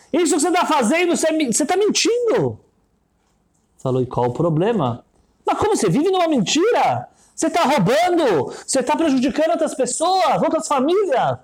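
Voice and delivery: put-on high, indignant girl's voice; girl confronting him. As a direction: challenge him, shocked and pleading. Girl confronts the rich man.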